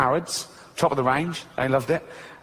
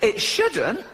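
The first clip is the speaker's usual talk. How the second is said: high goat voice, laughing; sheepish goat; 6 semitones higher than usual. Pretend. Goat answering interviewer, high and sheepish. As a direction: goat mumbles a reply.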